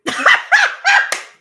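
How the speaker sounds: high squealing laugh burst; loud high cackle of delight; loud shrieking laugh. Real, not acted. Big laugh at cash gift.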